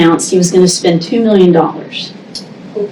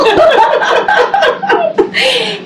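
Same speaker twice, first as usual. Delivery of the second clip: high burst of laughter. Real, not acted. Laughing at own retort.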